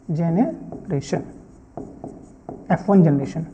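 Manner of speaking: Generation drawn out slowly in two parts; slow dictation. Shown as a demonstration. Teacher repeats the term for students, slow dictation. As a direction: split generation midway with a pause, low and slow.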